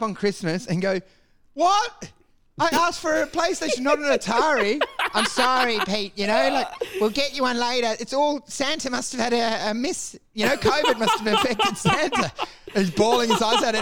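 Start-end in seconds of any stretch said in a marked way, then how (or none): 1.5-4.8 put-on high, indignant kid whining; a kid wailing at his parents in disbelief; outraged kid. An upset kid whining high at his parents on Christmas morning. 5.1-12.3 sheepish parent. Retold or imitated. Parent consoling kid, laughing.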